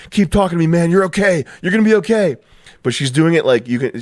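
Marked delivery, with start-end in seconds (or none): 0.1-2.4 high, louder mock panicked reassurance; imitating screaming cop. Female cop to the dying man, high mock-frantic reassurance. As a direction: ham up a frantic cop soothing the man she shot. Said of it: "the female cop is screaming".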